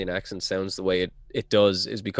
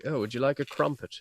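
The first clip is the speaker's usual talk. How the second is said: posh English; 4 semitones higher, 7 dB quieter than usual. Pretend. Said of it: "it's all like".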